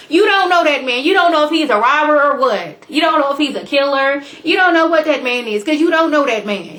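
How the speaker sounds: scolding rant. Real, not acted. Recapping a show, scolds the Chief of Staff loudly. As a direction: lecture her hard, you'd know better. Scolding the character for trusting stranger.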